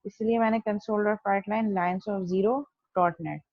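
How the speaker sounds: normal talking voice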